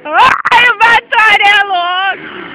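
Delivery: loud laughing cheer; excited shout. Real, not acted. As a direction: shout it out, thrilled. Cheering a rider's wheelie.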